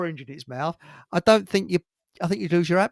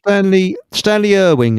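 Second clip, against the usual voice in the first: suddenly much louder, cutting in; loud interruption; 4 semitones higher, 11 dB louder than usual. Real, not acted. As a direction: blurt the name out over the others. Jumps in to name the man.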